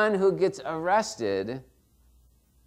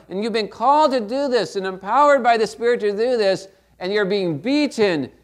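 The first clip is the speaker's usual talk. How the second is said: loud, higher, rising to full force; impassioned preaching; 6 semitones higher, 7 dB louder than usual. Acted. Preacher stirring the congregation, loud and impassioned. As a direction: pour it out over the congregation.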